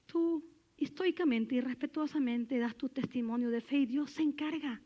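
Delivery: normal talking voice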